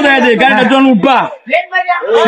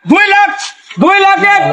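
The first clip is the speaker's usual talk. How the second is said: auctioneer; 4 semitones higher than usual. Acted. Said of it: "গাড়ি বিক্রি হবে নিলামে".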